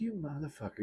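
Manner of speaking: hushed, high curse under the breath; muttered curse. Retold or imitated. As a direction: curse him quietly so he won't hear. Retold thought under her breath.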